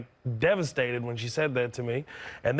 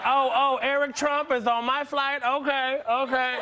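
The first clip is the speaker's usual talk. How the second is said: lightly voiced agent, pitched up; higher-pitched mock airline agent reacting; imagined gate agent; 10 semitones higher than usual. Gate agent spots Eric Trump on the flight, mock reaction.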